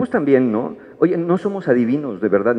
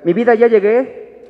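much higher, louder, slow sing-song greeting; sweet calling out; 10 semitones higher, 6 dB louder, slower than usual. Acted. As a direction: sing it from the doorway. Spouse arriving home sweetly.

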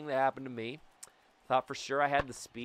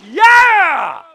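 much higher, loud victory shout; triumphant yell; 26 dB louder than usual. Real, not acted. Gamer celebrating his hustle triple, triumphant shout. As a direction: roar it out in triumph.

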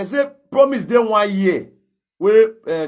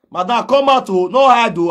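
raised, higher call to come out; loud summons, higher and louder; 4 semitones higher, 6 dB louder than usual. Real, not acted. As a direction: call her out so the whole street hears. Calling the woman out publicly.

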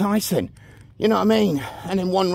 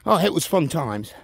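ordinary delivery

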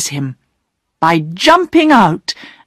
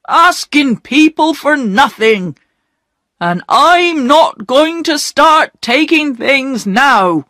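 higher, slow, stubborn mock shout; a poor man refusing charity, mildly raised; proud angry shout; 7 semitones higher than usual. Perks refuses the gifts, a mild hammed-up shout. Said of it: "they heard Perks shout".

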